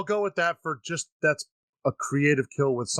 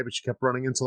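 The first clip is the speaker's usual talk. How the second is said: ordinary talk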